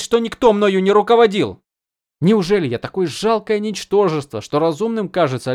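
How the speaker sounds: high, loud defiant outburst; defiant young woman. A narrator's reading. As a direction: push back hard, voice rising.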